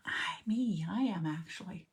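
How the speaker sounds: whispered admission of panic; whispered confession. Real, not acted. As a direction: whisper that you are the one panicking. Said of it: "who's panicking".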